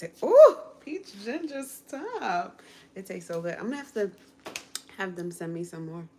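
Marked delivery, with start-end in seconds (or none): 0.2-2.6 high, loud delighted squeal over a drink. Real, not acted. Guest tasting peach ginger drink, delighted squeal. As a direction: squeal with joy at the taste.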